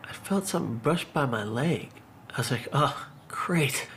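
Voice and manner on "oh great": sarcastic whisper. Retold or imitated. Sarcasm at a shark.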